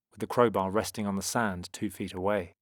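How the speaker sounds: ordinary delivery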